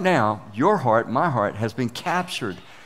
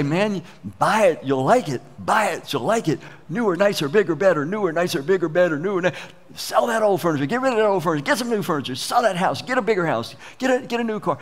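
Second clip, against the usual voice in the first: a hint of a pushy salesman, higher and eager; the world tempting the congregation to buy more, mock salesman pitch; 5 semitones higher, faster than usual. Preacher voicing the world's sales talk to his congregation, lightly hammed-up huckster. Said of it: "the world will say".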